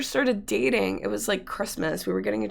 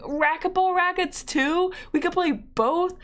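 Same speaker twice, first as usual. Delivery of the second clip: high, drawn-out mock amazement; wide-eyed gift-opening voice, acted out; imagined delighted surprise; 8 semitones higher, slower than usual. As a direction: pitch it way up, slow and thrilled.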